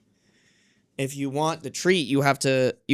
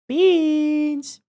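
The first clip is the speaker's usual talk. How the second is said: much higher, sing-song call to the cat; 12 semitones higher than usual. Real, not acted. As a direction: call the cat sweetly, pitched up. Calling the cat.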